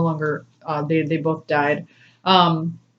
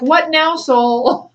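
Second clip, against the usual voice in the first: mock exasperated; 9 semitones higher, much slower than usual. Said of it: "I'm like".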